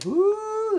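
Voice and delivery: real high, loud woo as the chili fumes burn; spicy whoop. Real, not acted. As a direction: whoop out as the heat hits your sinuses. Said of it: "that's burning my sinuses already".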